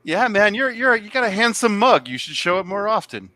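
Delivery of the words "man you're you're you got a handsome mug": normal talking voice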